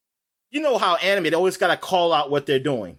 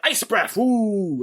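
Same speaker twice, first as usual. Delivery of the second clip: "Ice breath" called out a bit higher like a hero's move, then woo; mock Superman call-out; 6 semitones higher, much slower than usual. Mocking Superman naming his move, lightly called out "Ice breath". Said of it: "he says".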